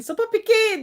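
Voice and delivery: high-pitched shout-out; hyped greeting. Real, not acted. Host welcomes a viewer joining the live, hyped greeting. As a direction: greet them with a high, excited lift.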